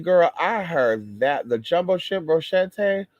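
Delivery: ordinary talk, nothing marked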